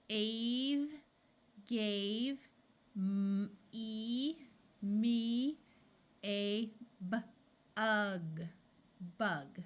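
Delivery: very slow, word by word; slow reading. Shown as a demonstration. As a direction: read it out slowly for a learner. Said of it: "reading slowly, sentence practice".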